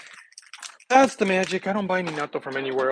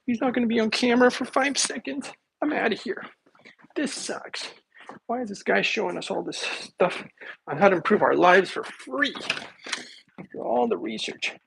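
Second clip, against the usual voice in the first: mock whiny viewer; 9 semitones higher than usual. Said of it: "you guys are gonna be like".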